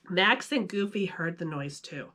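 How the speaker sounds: ordinary delivery, nothing marked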